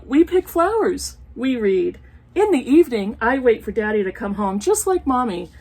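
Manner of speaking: sing-song picture book reading; reading to children. Acted. Reading an old picture book aloud to her viewers, storytime voice. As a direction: read it like a storytime page.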